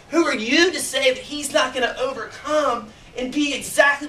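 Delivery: imitating an indignant scolding; retold rebuke. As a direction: challenge them with rising conviction. Retelling a woman's rebuke.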